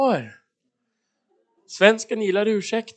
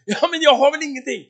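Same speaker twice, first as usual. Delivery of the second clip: high, loud, incredulous protest; protesting objection; 9 semitones higher, 6 dB louder than usual. Acted. Believer answering God's impossible demand, protesting. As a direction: object as if asked something absurd.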